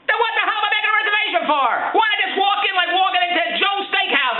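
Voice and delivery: exasperated rant. Real, not acted. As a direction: blow up at the absurd reservation rules. Ranting about the reservation.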